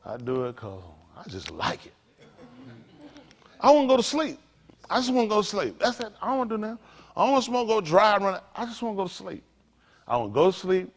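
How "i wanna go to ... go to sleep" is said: wanting to sleep. Retold or imitated. Preacher acting out his own weary wish, high and pleading. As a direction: whine it like a man who just wants sleep.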